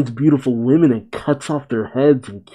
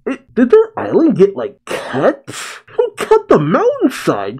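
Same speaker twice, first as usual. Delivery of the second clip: shocked; 9 semitones higher than usual. Real, not acted. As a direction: voice jumping up high in disbelief. Shocked at island damage.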